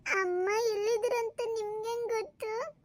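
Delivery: put-on high, slow, tearful boy; crying child. Boy questions stepmother about mother, tearful. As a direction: draw it out in a plaintive child's whine. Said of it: "ಅಳಬೇಡ".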